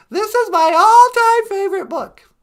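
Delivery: loud, eager praise; hearty enthusiastic plug; excited gushing. Real, not acted. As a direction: gush about the book. Enthusing about a book.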